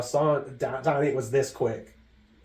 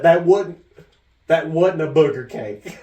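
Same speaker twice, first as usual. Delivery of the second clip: imitating Mom; 3 semitones higher, 9 dB louder than usual. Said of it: "she said".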